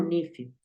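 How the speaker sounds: "nephew" said NIF-yu; short I in the first syllable of "nephew"; nephew variant with I. Shown as a demonstration. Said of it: "A veces lo he escuchado como nephew".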